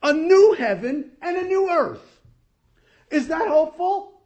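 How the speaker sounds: high, loud triumphant preaching; full-force preaching. Real, not acted. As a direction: proclaim it to the back row. Preacher calls for an amen.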